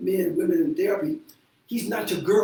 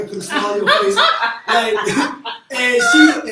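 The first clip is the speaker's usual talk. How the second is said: louder and fast, laughing through it; bursting into laughter mid-story; 5 semitones higher, 10 dB louder, slower than usual. Real, not acted. Telling the pickleball story, bursting into loud laughter.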